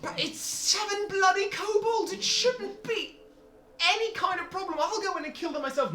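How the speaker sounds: high, furious crowd shout; angry shout. Acted. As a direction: yell it out in fury.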